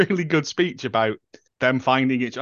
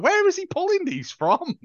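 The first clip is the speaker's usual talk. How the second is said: incredulous. Retold or imitated. Amused at the endless beer cans.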